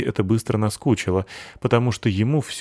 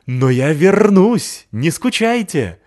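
high, slow, loud call; barked mid-leap; 9 semitones higher, 7 dB louder, slower than usual. Acted. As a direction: shout back while jumping the fence. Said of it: "гавкнув в полёте". (гавкнуть в прыжке)

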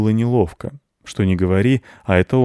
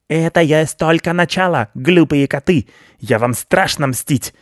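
gloating rat; 7 semitones higher than usual. Acted. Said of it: "хихикал крыс".

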